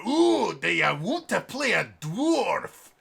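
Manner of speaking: hammed-up Scottish fantasy dwarf; Scottish dwarf accent. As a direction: drag it out in a stock dwarf brogue. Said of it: "dwarf accent".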